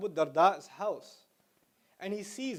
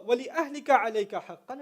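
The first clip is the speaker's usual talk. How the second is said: recited Arabic; much faster than usual. Shown as a demonstration. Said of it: "recites hadith".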